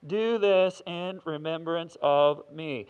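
loud, slow, booming divine command; God as a store loudspeaker, slow and loud; God over a loudspeaker. Retold or imitated. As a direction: announce it like God on a PA system. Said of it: "loud system like that".

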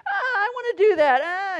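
very high, loud crying whine; whiny child. Retold or imitated. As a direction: wail like a little girl left out. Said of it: "this whine on her voice".

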